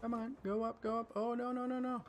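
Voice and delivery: high-pitched urgent pleading; alarmed. Real, not acted. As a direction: rattle it out in alarm as things go wrong. Player panics under attack.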